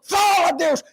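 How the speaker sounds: high loud shouted praise; congregation shout. Retold or imitated. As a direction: cry out like a fired-up crowd. Crowd shout imitated.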